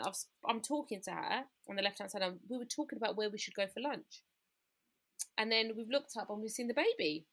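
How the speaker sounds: ordinary talk, nothing marked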